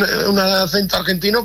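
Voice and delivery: ordinary delivery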